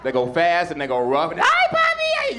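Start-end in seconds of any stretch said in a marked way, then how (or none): 1.4-2.4 very high, loud falsetto; woman's voice. Acted. Spanish woman calling to her lover, hammed-up falsetto. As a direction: squeal it in a put-on falsetto.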